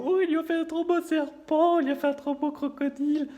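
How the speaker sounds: high, admiring onlookers; gushing parents. Retold or imitated. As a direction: coo over the balloon animal.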